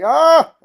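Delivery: high sudden yelp of fright; startled yelp. Retold or imitated. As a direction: yelp like a scared passenger. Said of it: "Lucius is like".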